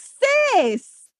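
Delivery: high-pitched mock disbelief; incredulous. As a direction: call her out, voice leaping up. Acts out disbelief at new parent.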